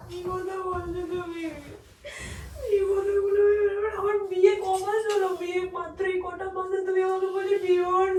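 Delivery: distraught, tearful appeal; crying. Real, not acted. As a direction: plead through sobs, losing strength. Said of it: "কান্না করো না".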